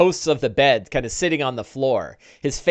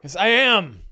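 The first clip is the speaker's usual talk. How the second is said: higher, louder proud boast; proud rancher; 8 semitones higher, 8 dB louder than usual. Acted. Big Al proudly answering the dwarf, high and loud. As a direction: brag about always having it.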